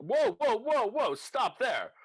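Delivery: high, loud, urgent halt; alarmed shout. Acted. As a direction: call out sharply to stop them. Character halts someone at the door.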